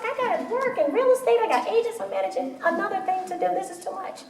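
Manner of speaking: playing my overwhelmed self, voice up high; a stressed mom squeaking out her to-do list; exasperated self. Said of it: "And I'm like".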